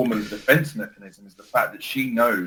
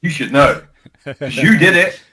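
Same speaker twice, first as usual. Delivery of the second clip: louder, higher imitation of an accusing wife; mock wife telling off; 6 semitones higher, 11 dB louder than usual. Husband imitating his wife's accusation, a louder, higher mock scolding. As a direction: throw it back at him like a scolding wife. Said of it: "And she's like".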